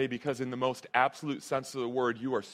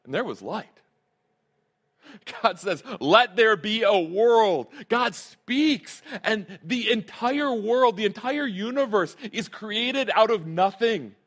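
loud, high, drawn-out proclamation; preaching at full force; 7 semitones higher, 6 dB louder than usual. Acted. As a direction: proclaim God's creating word with full force.

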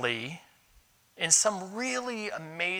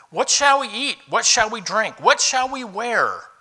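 higher and louder, fretting questions; mock anxious worry; 5 semitones higher, 6 dB louder, slower than usual. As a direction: play the anxious worrier.

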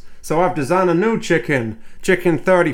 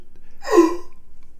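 sharp gasp; 13 semitones higher, 5 dB quieter than usual. Acted. Sudden alarm in sketch.

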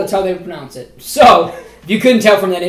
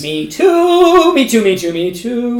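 excited; 3 semitones higher, 3 dB louder, slower than usual. Real, not acted. Eager agreement.

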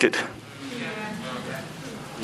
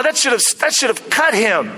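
ordinary talk, nothing marked

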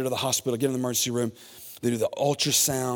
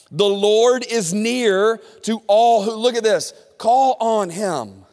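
slow, higher, louder reading of the verse; scripture reading; 10 semitones higher, 10 dB louder, slower than usual. Acted. Preacher reading Psalm to congregation, slow and raised. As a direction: lift the verse out slowly. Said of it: "Look at what the Bible says".

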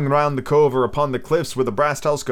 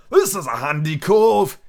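put-on higher, louder old seaman voice; old sea captain; 6 semitones higher, 4 dB louder than usual. Old captain praising the cove, gruff and loud. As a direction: play the gruff old buccaneer. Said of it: "high, old, tottering voice".

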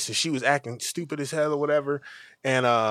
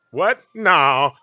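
louder, startled retort; taken aback; 8 dB louder than usual.

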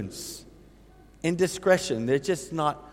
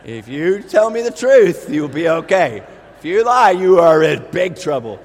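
stern mother; 10 dB louder than usual. Retold or imitated. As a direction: lay down the law. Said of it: "Megan saying, "Drew".

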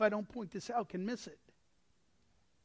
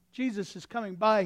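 ordinary talk

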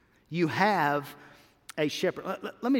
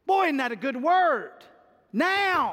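excited; 6 semitones higher, 3 dB louder than usual. Real, not acted. Preacher savoring the word now.

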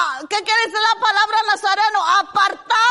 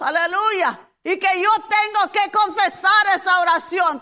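ordinary talk

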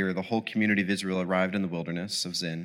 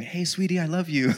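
higher, lightly sugary groggy greeting; mock sweet; 7 semitones higher than usual. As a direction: fake sweetness over tired words. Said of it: "tired and groggy".

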